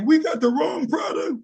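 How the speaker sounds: high, crying wail; mock the wife crying over the loss; imitating crying wife. Wife crying over forty dollars, high crying wail. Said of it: "Wifey started crying".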